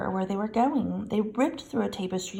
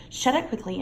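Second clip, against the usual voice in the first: usual speaking voice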